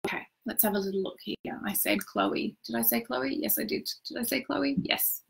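quiet aside while checking; muttering to self. Real, not acted. Coach checking whether she said Chloe, muttering to herself.

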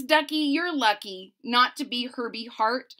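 ordinary delivery, nothing marked

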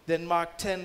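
usual speaking voice

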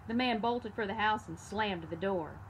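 ordinary delivery

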